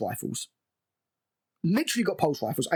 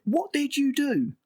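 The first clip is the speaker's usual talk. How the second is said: mock Wendy outcry; 11 semitones higher, slower than usual. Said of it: "And then she repeats it".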